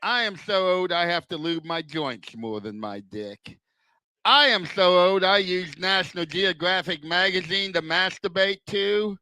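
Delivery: ordinary delivery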